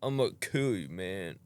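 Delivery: imitating a slow, druggy talker; slow-talking stoner imitation. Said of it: "he just slow talk".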